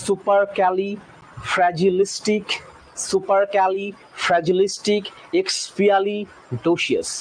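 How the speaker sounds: সুপারক্যালিফ্র্যাজিলিস্টিক drawn out slowly, syllable by syllable; slow teaching demo; slow spelling-out. Shown as a demonstration. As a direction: stretch every syllable of এক্সপিয়ালিডোসিয়াস. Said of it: "সেকেন্ডটা".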